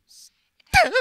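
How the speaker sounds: high, loud yell of his name; sharp shout. Acted. Yelling Stim's name in the fight, sharp shout. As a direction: call him out sharply.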